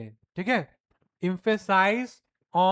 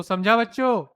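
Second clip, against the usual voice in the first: louder call to the class; raised voice; 4 semitones higher, 7 dB louder than usual. Shown as a demonstration. Teacher raising the voice to check the class is listening. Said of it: "जब हम voice को थोड़ा raise करते हैं".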